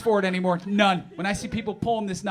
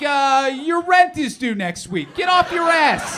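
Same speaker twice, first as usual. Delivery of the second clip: slow, loud, higher shouted retort; blunt mock shout; 6 semitones higher, 7 dB louder, slower than usual. Comedian yelling a reply at his moping buddy. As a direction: yell the wake-up call at him. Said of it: "And I was like".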